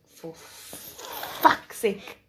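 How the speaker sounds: drawn-out, breathless mutter under the breath; muttered curse. Retold or imitated. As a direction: mutter it to yourself, slowly, nearly voiceless. Retold exasperation in her head.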